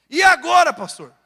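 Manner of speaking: acted plea bursting from silence; urgent appeal. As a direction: throw the question out in alarm. Acts out a desperate question.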